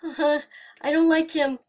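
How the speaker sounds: loud, high nervous laughing words. Real, not acted. Scared player laughing nervously at the animatronic. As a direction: laugh off the fear.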